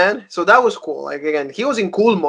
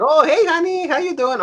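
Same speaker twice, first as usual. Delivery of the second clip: imitated wife's greeting; 11 semitones higher than usual. Wife greeting her husband on a video call, imitated high-pitched greeting. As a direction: put on a high, sweet-wife hello.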